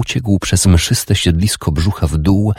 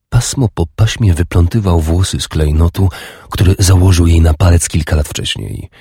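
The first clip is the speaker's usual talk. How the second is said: usual speaking voice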